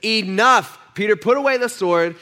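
firm command. Acted. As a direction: stop them short with authority. Jesus stops the sword fight.

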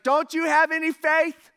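loud, high, incredulous question; exasperated rebuke. Acted. Jesus voiced correcting the disciples.

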